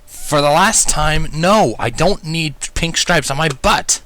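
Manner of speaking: slow, exasperated groan of a reply. A narrator's reading. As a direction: drag it out, fed up. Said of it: "I groaned out".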